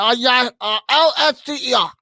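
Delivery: high, loud put-on character voice; Beetle. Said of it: "do it in the Beatle voice".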